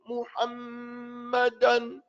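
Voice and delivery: "Muhammadan" slow, every vowel open; correct full vowels. Shown as a demonstration. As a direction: draw out "Muhammadan" with each syllable clear. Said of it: "Doesn't say".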